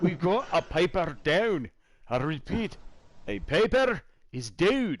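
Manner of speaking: high, slow mock-Scottish announcement; sing-song hammed-up Scottish; Scottish accent. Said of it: "that's pretty much Scottish".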